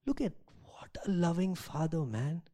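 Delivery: hushed, breathy aside; awed whisper. Real, not acted. Preacher marvels to congregation, whispering. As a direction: whisper it in wonder at the father.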